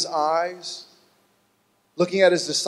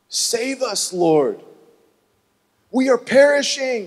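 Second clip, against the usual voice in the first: pleading cry; 7 semitones higher, 4 dB louder than usual. Acted. Disciples wake Jesus.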